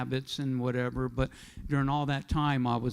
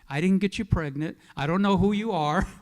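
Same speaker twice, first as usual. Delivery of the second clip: alarmed denial; 4 semitones higher, 5 dB louder than usual. Retold or imitated. Panicked denial to caller.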